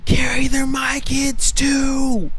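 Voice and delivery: slow high breathy whisper; hammed-up ASMR whisper; mock whisper.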